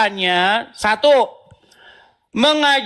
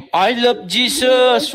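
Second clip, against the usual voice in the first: mock quote; much slower than usual. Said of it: "penghinaan yang dilakukan orang Islam".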